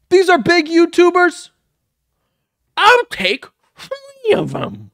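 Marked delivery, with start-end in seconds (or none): 2.8-4.9 very high, slow mocking mimicry; exaggerated mockery. Reactor parodies Shane's bit, high and drawn out. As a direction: drag it out high to ridicule the speaker.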